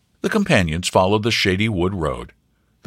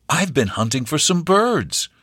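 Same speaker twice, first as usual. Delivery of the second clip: put-on kindly, higher young hunter; lightly voiced friendly stranger; kind young man; 9 semitones higher than usual. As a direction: speak kindly to win her trust. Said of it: "the stranger said kindly".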